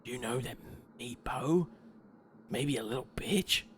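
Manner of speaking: V-Flip leaning in to share gossip, hushed slightly; leaning in to whisper. Acted. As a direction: lean in and murmur the gossip. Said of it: "leans in and says".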